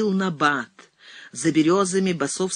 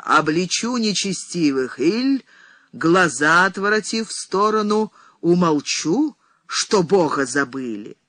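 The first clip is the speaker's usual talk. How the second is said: prophesying; 2 semitones higher, 3 dB louder than usual. A narrator's reading. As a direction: denounce like a prophetess. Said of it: "вещала старица".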